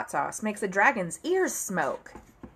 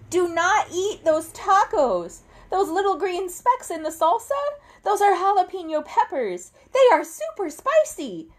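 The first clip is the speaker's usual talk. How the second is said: high, loud desperate pleading; urgent warning; 7 semitones higher, 6 dB louder than usual. Acted. Narrator pleading with the dragons not to eat, loud and high. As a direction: beg them to stop before it's too late.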